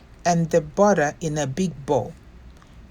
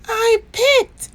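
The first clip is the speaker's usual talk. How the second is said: much higher, tiny confessing voice; very small voice; 5 dB louder than usual. A narrator's reading. Bear under the covers, confessing to Nora in a tiny voice. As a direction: squeak it out small. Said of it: "said Bear in a very small voice".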